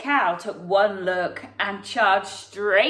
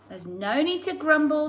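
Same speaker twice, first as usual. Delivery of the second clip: ordinary delivery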